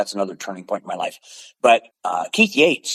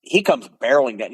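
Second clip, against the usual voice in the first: normal talking voice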